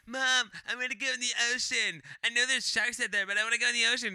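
high, loud mock kid voice; whiny little kid. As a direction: imitate a kid pestering his mom. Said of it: "this shitty little fat kid".

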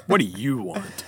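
offhand, as if interrupted mid-text; distracted, mildly rude. Busy lion looking up from his phone, mildly rude. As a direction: lightly imitate someone looking up from a phone. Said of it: "he's busy doing his own thing".

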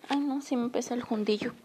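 ordinary delivery

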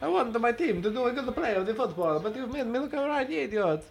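high, hammed-up Italian manager voice; mock Italian accent, pleading; Italian impression. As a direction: play the upset Italian manager. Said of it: "Italian impression".

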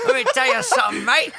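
high, hammed-up Aussie accent; laughing attempt at an Australian accent. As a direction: play up a chummy Australian. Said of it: "I'm getting a little bit better".

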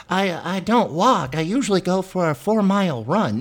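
high, slow whiny fan voice; drawn-out complaining follower; mock whiny listener. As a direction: whine it like a nitpicking fan.

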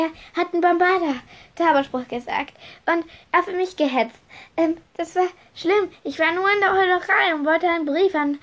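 high, halting, agitated account; flustered stammering. Acted. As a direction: blurt out excuses with false starts. Stotterte Draco.

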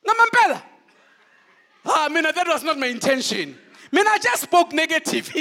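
high, loud mock pleading; Jesus pretending he only spoke negative, mock pleading; mock apologetic Jesus. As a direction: play it as a silly excuse. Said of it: "Did he go like".

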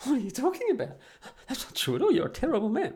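high mock voice of an offended girl; imitating girl. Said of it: "the girl's like".

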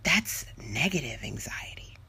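hushed aside, barely voiced; whisper. Speaker labelling negative anxiety to listeners, in a whisper. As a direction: drop into a breathy whisper for the label.

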